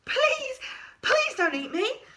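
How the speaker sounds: high, loud pleading cry; frightened scream. A narrator's reading. Creature begging Stitchhead not to eat it, panicked pleading. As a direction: beg for your life in a panic. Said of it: "screamed the creature".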